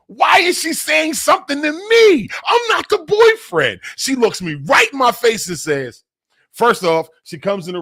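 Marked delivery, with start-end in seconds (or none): 0.1-3.8 shouted high in disbelief; indignant outcry. Real, not acted. Telling viewers Leah blamed him, shouted high in disbelief. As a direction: cry out, baffled and wronged.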